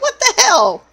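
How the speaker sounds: high-pitched, incredulous exclamation; mock outrage. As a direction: exclaim it in playful disbelief, pitch shooting up. Retells reaction to husband's odd description.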